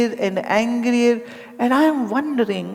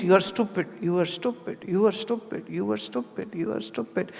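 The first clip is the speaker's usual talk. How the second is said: fading self-talk; 2 semitones lower, 8 dB quieter than usual. Retold or imitated. Obsessive self-repetition of insult.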